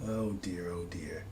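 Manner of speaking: oh dear trailing off into a whisper; mock dismay fading to a whisper. Teasing chat with mock dismay, whispered.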